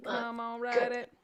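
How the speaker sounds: normal talking voice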